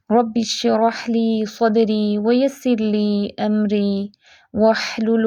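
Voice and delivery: slow, soft devotional recitation of the opening prayers; unhurried Arabic prayer chant. Acted. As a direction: recite it like a quiet, measured supplication.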